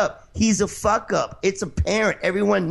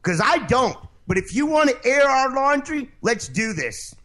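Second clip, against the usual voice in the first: angry challenge; 8 semitones higher than usual. Real, not acted. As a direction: square up to him, voice up and pushing. Heated on-air argument with host.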